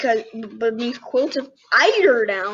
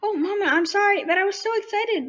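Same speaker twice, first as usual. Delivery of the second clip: higher, breathless little apology; excited bunny child; 8 semitones higher than usual. Acted. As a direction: hop with excitement.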